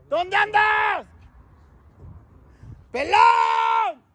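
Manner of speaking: high shout up the building; calling out high. Real, not acted. Calling a friend from the street, high shout. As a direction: call out over the street.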